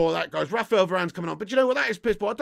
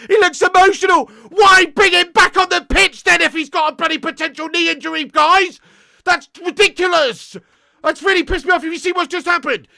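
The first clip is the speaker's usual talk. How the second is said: shouting angrily, much higher and louder; furious shouting; 8 semitones higher, 14 dB louder than usual. Real, not acted. Football fan ranting to stream viewers about the injury, furious shout. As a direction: blow up at the manager's call at full volume.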